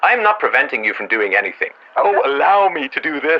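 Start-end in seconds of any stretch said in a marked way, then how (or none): 2.0-3.4 slow, high sneering parody; high mocking imitation, drawn out; mocking mimicry. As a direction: mimic him to ridicule him. Mocking the other's phrasing.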